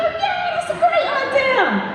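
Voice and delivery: high, smashed and eager yes; drunken eager yes. Retold or imitated. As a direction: say it like you're smashed and thrilled. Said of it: "You go".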